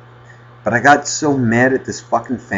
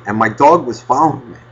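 ordinary talk, nothing marked

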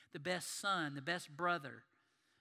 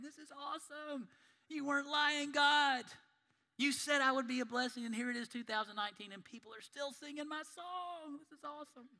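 much higher, delighted voice with a laugh at the end; Abraham laughing in heaven; 11 semitones higher, 7 dB louder than usual. Acted. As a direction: play a giddy Abraham thrilled in heaven. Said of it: "Abraham's laughing in heaven going".

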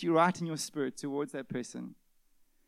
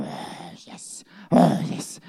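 mock relish; 10 dB louder, much slower than usual. Mimicking relishing rumination.

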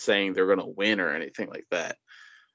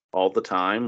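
usual speaking voice

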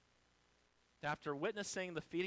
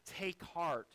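ordinary talk, nothing marked

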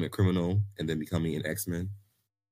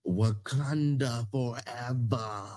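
slow, higher, grand declaration; Wakanda forever salute; 5 semitones higher, much slower than usual. Pretend. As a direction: proclaim it slowly like a battle cry. Quotes the famous salute.

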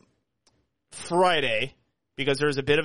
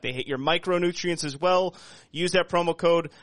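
ad read; 4 semitones higher than usual. Acted. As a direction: sell it like a radio ad. Podcast host reads sponsor ad.

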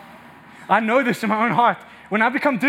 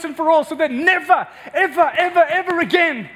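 high, driving sermon delivery; impassioned preaching; 4 semitones higher than usual. Real, not acted. Preacher telling the church sin was exposed on Christ, impassioned. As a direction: build to forceful, emphatic preaching.

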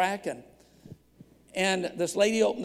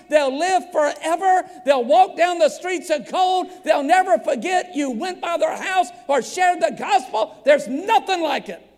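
high, loud, driving sermon voice; full-force preaching; 7 semitones higher, 7 dB louder than usual. Real, not acted. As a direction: preach it out urgently to the congregation.

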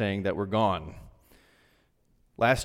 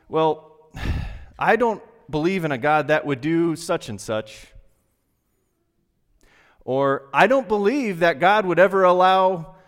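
skeptical churchgoer; 5 semitones higher, 6 dB louder than usual. Retold or imitated. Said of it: "You hear people say".

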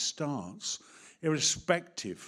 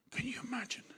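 whisper; 9 semitones higher, 10 dB quieter than usual. Real, not acted. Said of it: "whispered aside".

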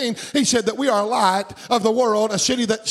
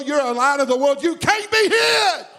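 preaching shout; 5 semitones higher than usual. Real, not acted. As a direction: belt it out to the church. Preacher peaks, declaring to congregation.

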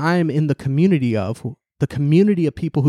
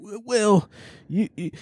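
mock sputtering, higher-pitched and halting; flustered stammer; 7 semitones higher, 4 dB quieter than usual. Imitating critics who object to the troll, flustered stammer. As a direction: imitate someone lost for a comeback.

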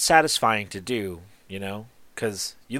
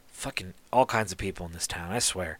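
grumbled aside; 4 semitones lower, 8 dB quieter than usual. Acted. Artisan grumbling about strangers in town, low and soft. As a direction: lower and softer, muttered under the breath.

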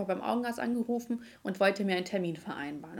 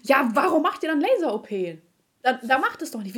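loud, raised, exasperated retort; empörte Widerrede; 4 semitones higher, 6 dB louder than usual. Retold or imitated. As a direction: throw it back, louder and indignant. Indignant retort to receptionist's remark. (laut und empört gekontert)

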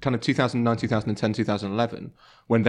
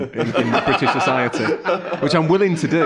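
laughing; 4 semitones higher, 4 dB louder than usual. Real, not acted. Laughing through joke offer.

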